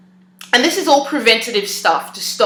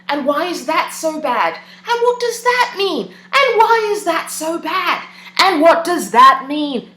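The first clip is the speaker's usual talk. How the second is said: slow, much higher, building then easing back; spiralling questions; 8 semitones higher than usual. Shown as a demonstration. Showing viewers the looping questions, slow and pitched up high.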